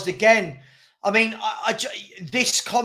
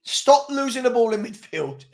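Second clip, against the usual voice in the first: ordinary talk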